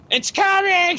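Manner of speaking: very high, strained shriek; high-pitched imitation yell of alarm; mock labour scream. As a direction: shriek it like a woman in labour, hammed up. Acts out woman screaming in labour.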